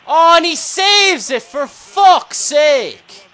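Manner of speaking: high, loud, drawn-out frustration; real agonised swearing outburst; exasperated shout. Real, not acted. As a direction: yell in fury at the save. Penalty is saved.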